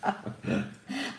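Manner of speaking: laughing. Real, not acted. Cracking up at own impression.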